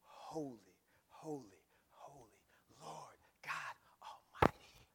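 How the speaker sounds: low, hushed holy chant; awestruck angelic worship. Acted. Preacher as angels worshipping before God, hushed awe. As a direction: let each word sink into near-whispered awe. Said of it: "you'll be just like the angels".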